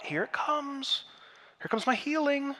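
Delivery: hopeful inner voice. Retold or imitated. Paralyzed man thinking to himself before Jesus speaks, eager and hopeful. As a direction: voice the paralyzed man's rising hope, lifted high. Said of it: "And I'm sure he thought".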